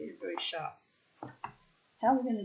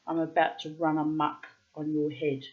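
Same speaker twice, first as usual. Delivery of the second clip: usual speaking voice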